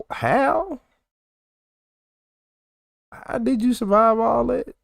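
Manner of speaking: high, disbelieving gasp; can't believe she lived; stunned disbelief. Real, not acted.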